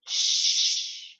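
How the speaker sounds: long unvoiced "shh" on its own; isolated sh sound. Shown as a demonstration. Teacher showing two letters making one sound, held shh. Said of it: "to make that one sound".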